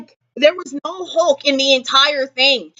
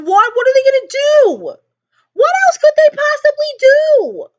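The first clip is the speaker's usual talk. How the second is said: high despairing wail; 12 semitones higher, 4 dB louder than usual. Real, not acted. Despair over doomed heroes.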